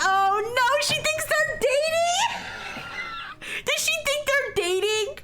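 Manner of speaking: real shock at the twist; shocked disbelief. Real, not acted. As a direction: react in horrified amusement. Shocked reaction to the plot.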